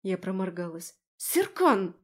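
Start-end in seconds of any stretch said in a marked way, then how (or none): 1.2-2.0 high, loud cry of the dog's name; exclaiming. A narrator's reading. Owner runs to grab her dog, exclaiming loudly. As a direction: call out sharply to the dog. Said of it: "воскликнула".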